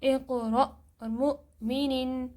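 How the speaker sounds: اقرأ and مؤمنین slow, each hamza stopped sharply; slow demonstration of the hamza stops; with a jerk. Shown as a demonstration. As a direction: recite both words with a clear glottal catch. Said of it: "جھٹکا".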